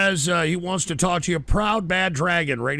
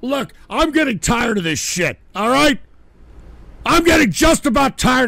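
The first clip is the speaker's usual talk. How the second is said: furious shout; 8 dB louder than usual. Acted. Host yelling at the booth to cut the audio, furious screech. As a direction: scream to kill the noise.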